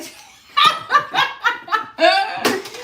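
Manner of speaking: loud high laugh bursting out; helpless loud laugh; big burst of laughter. Real, not acted. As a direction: crack up laughing hard. Laughing at too much ice.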